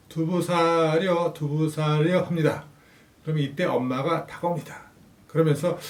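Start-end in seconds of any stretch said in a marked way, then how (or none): 0.1-2.2 slow, drawn-out street hawker's cry; tofu peddler's call. Pretend. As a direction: stretch it out like a peddler selling tofu. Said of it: "아빠는 아이를 업은 상태에서".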